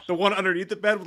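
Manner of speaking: usual speaking voice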